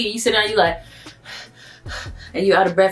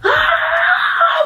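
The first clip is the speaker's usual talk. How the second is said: panicked cry. Retold or imitated. Narrator recalling the airport sprint, panicked high cry. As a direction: let out a shouted cry mid-sprint.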